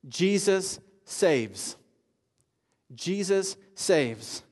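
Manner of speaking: "Jesus saves" drawn out, very slow and weighty; slow solemn proclamation. Real, not acted. Preacher proclaims to doubters, slow and solemn. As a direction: let "Jesus saves" ring slowly. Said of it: "take a long, hard look".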